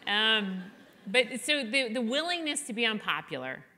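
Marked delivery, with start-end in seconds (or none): 0.0-0.1 high mocking blah-blah, brushing her off; sing-song it higher like a sulky kid; mock whiny blah. Daughter mocks her mother's lecture, high sulky blah-blah. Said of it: "And I was like".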